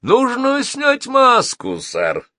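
high, slow, smiling demand; smiling villain, pitched high; smiling menace. A narrator's reading. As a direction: draw it out with a smile. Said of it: "улыбнулся Мамис".

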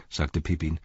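plain reading voice

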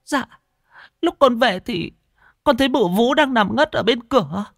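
very high, whimpering report; high-pitched, upset daughter-in-law; tearful young woman. A narrator's reading. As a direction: explain in a high, distressed voice. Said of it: "Chị Ngoan mếu máo".

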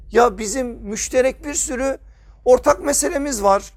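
ordinary talk

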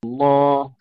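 slow, measured tajweed recitation with long held vowels; Quran recitation. Acted. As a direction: recite slowly, drawing out the elongations and nasal sounds. Recites verses before teaching them. (تلاوة مرتلة بطيئة بأحكام التجويد)

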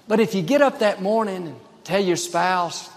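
usual speaking voice

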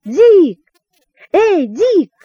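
high, loud calls with falling tails; girl crying out to a friend; calling out loudly. A narrator's reading. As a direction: holler a name from afar. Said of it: "кричала во всё горло".

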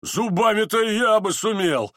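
high, slow, strained voice; desperate grinding cry. A narrator's reading. The fist gentleman at the back despairs, grinding cry. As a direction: grind it out slowly, pitched high. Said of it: "проскрежетал кулачный господин ... припадке решительного отчаяния".

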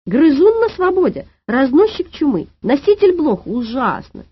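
high, loud outcry of horror; yelling. A narrator's reading. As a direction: reel off the horrors in alarm. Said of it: "заорала дама". (вопль ужаса)

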